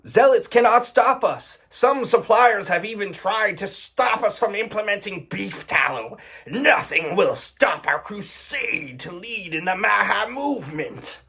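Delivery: mock epic corporate voice; mock crusader. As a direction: read it like a pompous crusade speech. Mocking grandiose ad quote.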